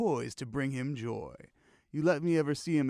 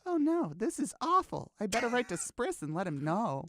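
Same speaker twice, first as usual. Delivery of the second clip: worried lady voice; 7 semitones higher than usual. Acted.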